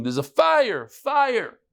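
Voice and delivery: mock alarm call, the second fire shot high; retold shout of fire. Rabbi yelling to his students to stop himself, mock alarm shout. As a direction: cry out fire as if raising the alarm. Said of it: "He started screaming".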